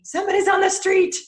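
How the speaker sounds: high, loud yappy alarm as the dog; voicing the dog Finley raising the alarm, yappy and high; dog's alert voice. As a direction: sound the alarm like a guard dog. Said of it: "Finley is gonna start barking".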